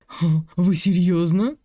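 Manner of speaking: slow amused laugh. Acted. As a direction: laugh through the question. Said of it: "продолжал от души смеяться".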